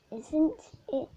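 hammed-up squeaky imitation; mock high voice.

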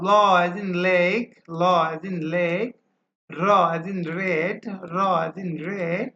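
drawn-out "ল as in lake" and "র as in red", rising; slow model pronunciation. Shown as a demonstration. Teacher drilling the sounds for students, slow and rising. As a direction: stretch each sound slowly with a lift at the end. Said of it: "তারপর হচ্ছে ল".

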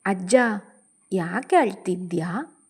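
put-on innocent child. A narrator's reading. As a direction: gently play a three-year-old's innocent question. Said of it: "ಮುಗ್ಧವಾಗಿ ಕೇಳಿತು".

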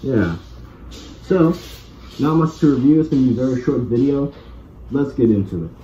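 speaking inside rubber face mask; breathing through filter hose; muffled through gas mask. Real, not acted. Seen 1: Mask covers the whole face while speaking; removed by 11.4.

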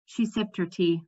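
ordinary delivery, nothing marked

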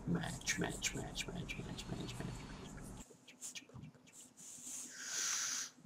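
rapid whisper dying away; fading whispered repetition. Pretend. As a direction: let the word patter off into nothing. Skipping to the next match.

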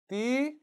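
high 띠 sweeping upward; second tone. Shown as a demonstration. Teacher calling 이성, 띠 in a rising tone. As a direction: glide 띠 up to a high finish. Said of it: "이성".

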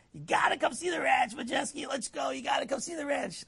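hammed-up thrilled invitation; excited rabbi. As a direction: imitate an overjoyed rabbi inviting. Imitating excited rabbi calling.

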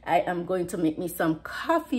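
ordinary talk, nothing marked

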